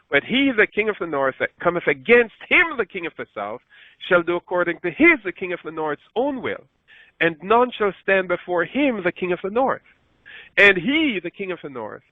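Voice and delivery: preacher reading with mounting emphasis; amplified scripture reading. Acted. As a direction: build the verse up with explanations woven in. Verse sixteen again.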